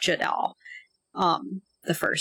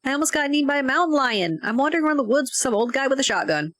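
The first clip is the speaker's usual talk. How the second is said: high-pitched, urgent complaint; alarmed report; 8 semitones higher, faster than usual. Acted. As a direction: blurt out the scare over the phone. Player character reports danger on phone.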